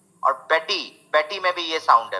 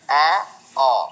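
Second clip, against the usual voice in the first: ordinary delivery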